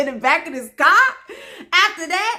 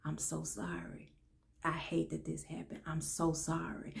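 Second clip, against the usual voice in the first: barely voiced, confiding; whispered apology; 8 semitones lower, 18 dB quieter than usual. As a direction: retell a hushed apology in someone's ear. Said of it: "He whispered in my ear".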